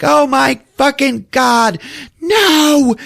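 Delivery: high, loud, drawn-out wail of no; anguished scream. Retold or imitated. Wife reacting to her husband's refusal, anguished loud high wail. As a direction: cry out in despair, stretched slow.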